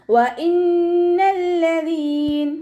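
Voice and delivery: slow, high, melodic tajweed chant; Quran recitation. Shown as a demonstration. Teacher chants the verse for students, slow and melodic. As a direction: draw each syllable out in a sung recitation.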